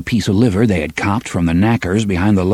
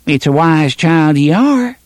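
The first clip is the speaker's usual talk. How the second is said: put-on grinning boy; 7 semitones higher than usual. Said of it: "The first boy grinned".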